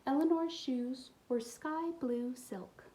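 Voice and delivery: ordinary delivery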